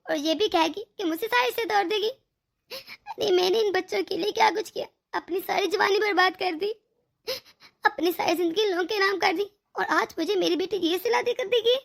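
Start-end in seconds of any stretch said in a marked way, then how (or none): 2.7-3.3 crying out. Acted. Mother weeps over daughter leaving. 5.1-9.5 tearful. Acted. Mother weeps over daughter leaving.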